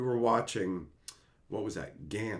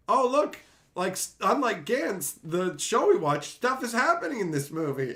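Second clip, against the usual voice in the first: raised pitch, eager viewer reaction; mock excitement; 7 semitones higher than usual. Viewer first watching the Gantz movie, mock-impressed excitement. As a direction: play up a hopeful first impression.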